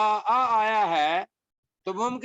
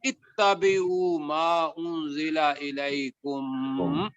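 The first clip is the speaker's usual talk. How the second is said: slow melodic recitation; Quran recitation; 3 semitones lower, much slower than usual. Shown as a demonstration. Teacher recites Quran verse.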